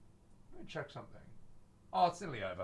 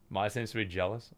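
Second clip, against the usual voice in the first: usual speaking voice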